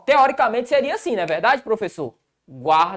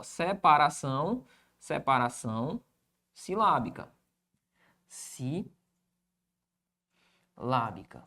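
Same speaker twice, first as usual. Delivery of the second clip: low, soft, syllable by syllable; writing-along voice; 7 semitones lower, 9 dB quieter than usual. Real, not acted. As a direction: draw the words out quietly, with pauses. Writing words on the board.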